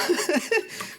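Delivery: high, loud nervous giggle; nervous laugh. Real, not acted. Poet facing a returning audience, laughing nervously. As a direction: break into a high, quick laugh.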